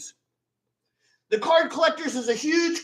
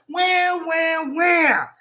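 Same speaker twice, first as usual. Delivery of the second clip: mock whiny where; 4 semitones higher, much slower than usual. Mocking crybabies searching the damage.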